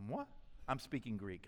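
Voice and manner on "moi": joking moi. Retold or imitated. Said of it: "whenever I go".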